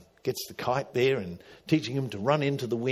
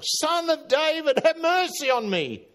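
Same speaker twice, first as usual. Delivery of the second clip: high, louder desperate cry, slowed; 5 dB louder than usual. Retold or imitated. Beggar shouts over the crowd to Jesus, louder high cry. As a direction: push the plea harder, calling over the crowd. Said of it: "he cried out all the more".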